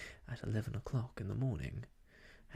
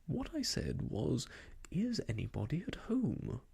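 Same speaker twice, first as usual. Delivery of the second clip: plain reading voice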